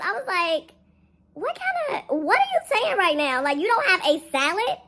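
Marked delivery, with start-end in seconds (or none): 1.3-4.8 high, slow, mildly disbelieving question; can't believe what she's hearing; incredulous. Retold or imitated. Disbelief at worker's answer.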